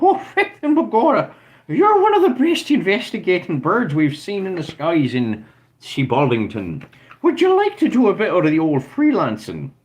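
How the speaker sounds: put-on Irish accent. Irish police officer recruiting the bird detective, in an Irish accent. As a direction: play a jolly Irish cop offering work in an Irish accent. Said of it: "he's gotta be Irish".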